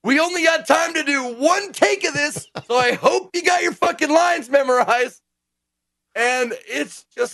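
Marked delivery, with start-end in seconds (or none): 0.0-5.2 high-pitched, loud, put-upon director; mock exasperated director. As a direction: warn the cast like a harried director. Mimics a hurried, fed-up director.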